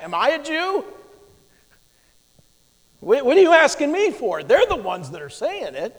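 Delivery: high, indignant shot back; sharp retort. Retold or imitated. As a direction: fire it back at the prisoner. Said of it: "Pilate shoots back".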